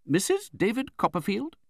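high-pitched, questioning matron; imperious aunt. A narrator's reading. Strange aunt addresses the widow on arrival, imperious. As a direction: demand to know, high in pitch.

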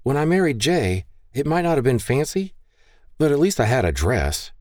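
lightly voiced young bride, mildly fretting; Maggie's voice. Maggie complains about no dress.

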